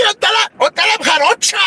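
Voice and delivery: high squeal of alarm at what she finds; panicked shriek. Retold or imitated. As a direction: shriek it in sudden horror. Busca su vagina, alarmada. (chillido de pánico, muy agudo)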